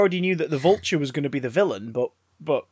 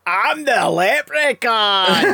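put-on slow, high Scottish accent; 6 semitones higher, 5 dB louder, much slower than usual. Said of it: "Wrong accent, uh, [name]. ... Scottish accent".

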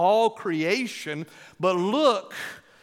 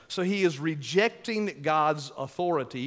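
ordinary delivery

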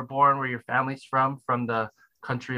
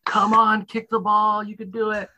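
a light, higher mock coach cheer; 8 semitones higher than usual. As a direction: egg the kid on like a soccer coach, lightly. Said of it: "the coach is like".

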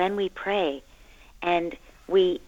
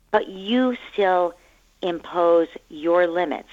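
ordinary talk

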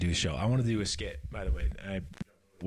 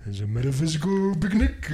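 cartoon bear voice, higher and louder; Yogi Bear voice; 5 semitones higher, 5 dB louder than usual. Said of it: "I love the Yogi Bear".

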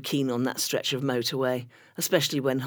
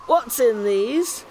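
slow, higher and louder, put-on voice; suspicious query; 7 semitones higher, 4 dB louder, much slower than usual. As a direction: drag it out slowly, high and wary. Suspicious teen at picnic.

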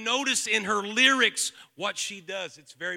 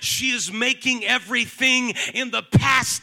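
loud, climbing sermon shout; full-force preaching; 4 semitones higher, 14 dB louder than usual. Real, not acted. Preacher declaring God's promise already fulfilled, full-force preaching. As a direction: drive it home at the top of the voice.

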